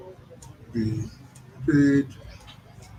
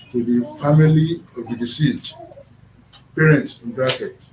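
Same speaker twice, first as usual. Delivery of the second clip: louder, clear read-back; reading aloud loudly; 3 semitones higher, 6 dB louder than usual. Real, not acted. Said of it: "Read what you write there".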